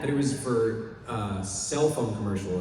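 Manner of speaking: ordinary delivery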